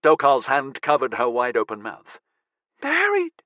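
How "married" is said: very high, astonished squeak. A narrator's reading. Friend hears that the crew leader has married, squeaking. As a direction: squeak it in disbelief, right up high. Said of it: "she squeaked when he'd finished".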